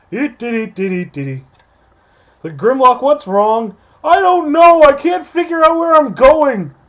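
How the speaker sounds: high, loud, goofy character voice; silly voice. Pretend. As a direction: squeak it out like a confused toy. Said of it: "tried doing something silly this time".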